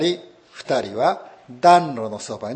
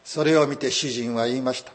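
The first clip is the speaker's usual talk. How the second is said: normal talking voice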